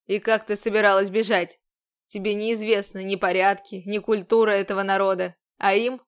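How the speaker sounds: a commander bearing down on her; stern commander. A narrator's reading. Commander role.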